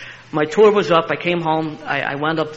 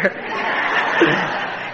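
unvoiced chuckle; 3 semitones higher than usual. Real, not acted. Laughs at his own line.

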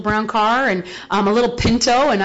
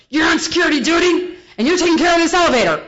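higher, louder order, fast; barked order; 7 semitones higher, 4 dB louder, faster than usual. Retold or imitated. Harlan assigning elevator duty, loud and higher. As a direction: hand out the duty like a shout. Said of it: "he goes".

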